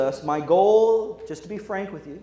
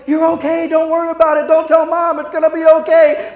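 higher, louder frantic pleading; 10 semitones higher, 9 dB louder than usual. Retold or imitated. Brother to crying sister, loud frantic pleading. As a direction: beg her loudly not to tell.

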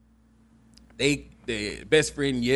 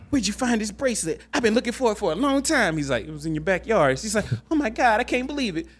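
excited wife; 8 semitones higher, faster than usual. Retold or imitated. Wife thrilled about found bracelet.

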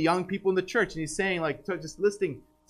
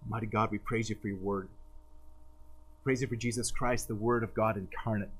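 lower, softer praying voice; pastoral prayer; 7 semitones lower, 5 dB quieter than usual. Acted. Preacher praying for the congregation, low and hushed. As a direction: pray over the church, lower and hushed.

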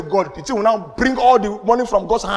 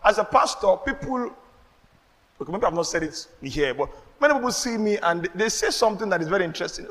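ordinary talk, nothing marked

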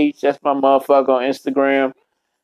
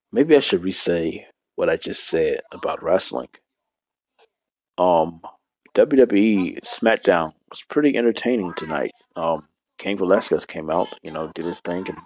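low, soft voice; 6 semitones lower, 5 dB quieter than usual. Shown as a demonstration. Vlogger recapping wrestling in his new voice, low and soft. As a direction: keep it low and hushed. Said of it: "Maybe I should talk like this".

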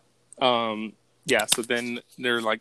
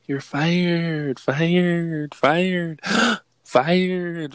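loud, higher, stretched-out "fired"; long mock bellow with a gasp; drawn-out mock firing; 5 semitones higher, 7 dB louder, much slower than usual. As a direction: imitate a boss bellowing, gasping for breath between repeats. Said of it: "he had to take a deep breath".

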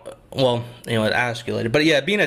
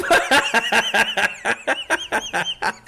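long laugh; 8 semitones higher than usual. Real, not acted. Laughs at childhood memory.

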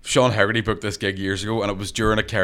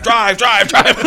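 urgent shout; 11 semitones higher, 7 dB louder, much slower than usual. Retold or imitated. Fleeing the bar in a car.

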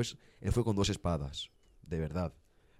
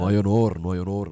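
loud declaration; 10 dB louder than usual. Pretend. Mock solemn outburst.

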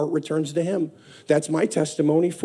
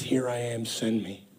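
hushed surrender; 9 semitones lower, 10 dB quieter than usual. Retold or imitated. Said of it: "He says".

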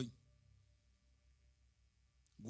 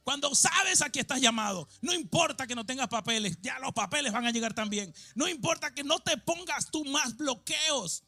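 loud, rising sermon cry; preaching at full force; 7 semitones higher, 8 dB louder than usual. Acted. Pastor urging the congregation to dream big, loud fervent preaching. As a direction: preach it out to the whole room.

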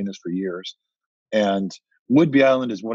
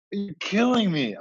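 mock exasperation; 11 semitones higher than usual. Laughing at retold frustration.

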